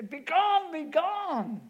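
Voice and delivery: high, loud commanding shout. Retold or imitated. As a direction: roar it to drive the devil off. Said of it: "I've had to go in the country and say".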